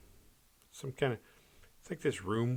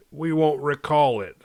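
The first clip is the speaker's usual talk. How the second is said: mock official; 4 semitones higher, 8 dB louder than usual. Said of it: "that old Fight Club reference".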